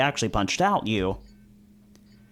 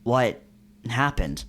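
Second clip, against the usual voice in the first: usual speaking voice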